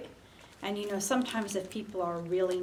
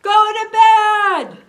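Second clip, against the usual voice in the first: very loud, high, drawn-out shout; old man yelling at noisy neighbours; hollering old man; 12 semitones higher, 17 dB louder, slower than usual. Acted. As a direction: holler it at the top of your voice. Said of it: "An old man is hollering".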